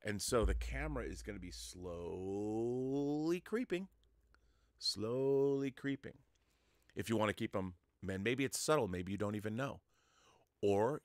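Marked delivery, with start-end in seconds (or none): 1.5-6.2 drawn-out slow creeping words; slow, creeping delivery. Shown as a demonstration. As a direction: stretch it out like a slow dolly move. Describing a creeping dolly shot.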